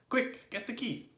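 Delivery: urgent high, loud call. A narrator's reading. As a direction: call up to someone on the roof. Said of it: "called out to the roly-poly bird".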